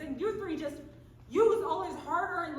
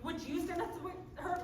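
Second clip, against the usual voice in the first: usual speaking voice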